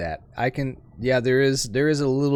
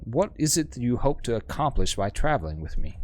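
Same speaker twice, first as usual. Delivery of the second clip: usual speaking voice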